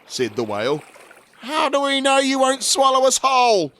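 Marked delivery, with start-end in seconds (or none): 1.4-3.7 put-on high, louder, suspicious boy's shout. A narrator's reading. Michael doubting the whale, high challenging shout. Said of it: "shouted Michael".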